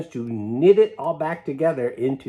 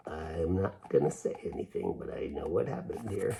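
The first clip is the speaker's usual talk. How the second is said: softer, lower, knowing aside; secretive; 8 semitones lower, 11 dB quieter than usual. Acted. Dog's face saying he knows, quietly sly. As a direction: keep a secret under your breath. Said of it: "a face that says".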